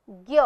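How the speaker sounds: "ज्ञ" pronounced as one syllable, "gya"; conjunct pronunciation. Shown as a demonstration. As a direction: teach "ज्ञ" as a single "gya" sound, set apart. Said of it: "इसका उच्चारण बन जाता है". ("ज्ञ" का "ग्य" जैसा उच्चारण)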